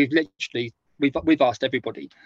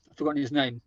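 ordinary talk, nothing marked

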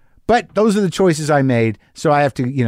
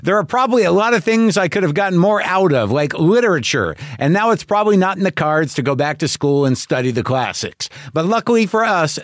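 upbeat podcast ad read, a bit higher; 5 semitones higher than usual. Acted. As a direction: sell the show, friendly and bright. Host reads podcast ad.

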